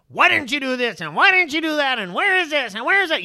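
high, loud, relentless mock nagging; nagging, exacting parent. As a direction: imitate a parent hounding a child. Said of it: "Just be so hard on them".

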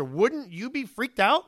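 ordinary talk, nothing marked

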